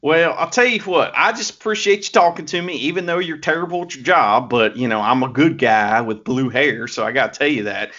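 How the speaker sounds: put-on Southern accent, lightly done; a hint of a put-on American South accent; Master Wato Southern accent. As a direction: play a folksy Southern good-guy wrestler. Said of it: "Southerners with that kind of accent".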